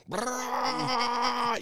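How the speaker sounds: high buzzing brrrr of a small dirt bike; imitated engine rev. Dad imitating son's bike pulling away, high engine buzz. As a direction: mimic the motorcycle revving off.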